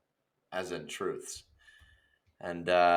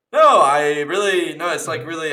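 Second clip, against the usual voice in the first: hammed-up insistence, pitched up; over-earnest protest; 7 semitones higher, 11 dB louder than usual. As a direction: placate Dad loudly and too eagerly. Acted kid reassuring hurt dad.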